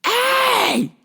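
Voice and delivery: high yelled hey; angry shout. Retold or imitated. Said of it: "was like".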